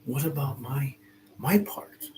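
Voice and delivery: high, whiny mock protest about one's efforts; imitating complaint. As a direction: play the hurt believer, lightly.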